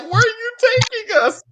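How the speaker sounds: high-pitched whiny imitation; mock crying. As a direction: wail it like a hammed-up frightened brother. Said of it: "Why are you crying?".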